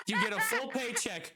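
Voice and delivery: words breaking into laughter; can't hold back the laugh while saying it. Real, not acted. Manager laughing while sending him home.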